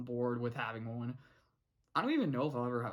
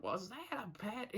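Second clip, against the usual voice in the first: high, slow, softer cartoon voice; Hop Pop; 6 semitones higher, 7 dB quieter than usual. Imitating Hop Pop hearing a bump downstairs, high put-on voice. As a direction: stretch it out in a put-on character voice. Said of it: "Hop Pop's like".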